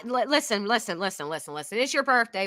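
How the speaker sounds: ordinary delivery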